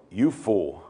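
low, soft pronouncement; acted divine verdict, dropped low and quiet; grave rebuke. Preacher voices God's rebuke.

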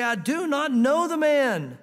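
loud, slow, cursing denial; angry denial. Acted. Peter cursing at the bystanders, a slow loud denial. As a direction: swear it out loud, slowly.

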